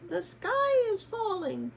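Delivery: high, loud hen alarm; squawking hen. Acted. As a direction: squawk it again in panic. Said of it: "she squawked".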